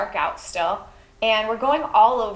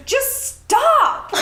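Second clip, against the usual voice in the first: high, louder cry of stop; mom yelling; 5 dB louder than usual. Retold or imitated. Mom in the cold yelling at her daughter to stop. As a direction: imitate a fed-up mother's yell. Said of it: "She goes".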